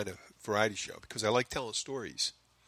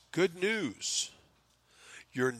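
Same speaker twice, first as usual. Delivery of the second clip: higher-pitched mock announcement of good news; 8 semitones higher, much slower than usual. Podcasting site emails the host his ranking, mock good-news announcement. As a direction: announce it like a winning notification, pitched up. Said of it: "it informed me that".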